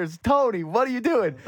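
very high-pitched, incredulous wail; mock exasperation. Host scolding a commentator for jinxing it, high mock exasperation. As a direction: plead in hammed-up disbelief.

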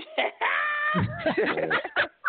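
big high-pitched laugh; gloating laugh. Real, not acted. Laughing after his own trash talk.

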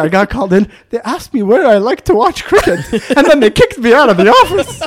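loud, high mock grievance building; Ali's perspective. Ali tells his side of the story, rising indignation. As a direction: tell it as the bewildered junior, laughing. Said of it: "his side of the story".

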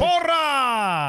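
shouted cheer. Acted. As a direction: call out the cheer. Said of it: "Una porra, una porra para los chavos".

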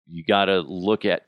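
usual speaking voice

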